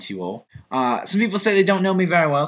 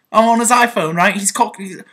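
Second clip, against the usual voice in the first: put-on chatty London English accent. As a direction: confess in a lively southern English accent. Confesses to being English in changed accent.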